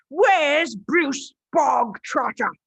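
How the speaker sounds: slow, loud bellow; fearsome headmistress. Acted. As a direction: demand him like a tyrant. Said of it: "the Trunchbull barked suddenly".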